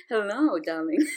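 soft, low seductive devil; Lucifer voice. Pretend. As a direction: murmur it like a charming devil. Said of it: "that's my Lucifer voice".

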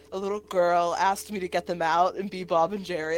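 usual speaking voice

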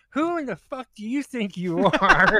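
loud, high angry shout; furious yell. Retold or imitated. Admin yelling at a rude page member, loud and furious. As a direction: blow up at her, full volume. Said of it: "And then I'm like".

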